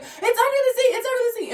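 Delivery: very high, squealing imitation of a friend; panicked shriek. Friend pointing to dead animal under seat, shrieked.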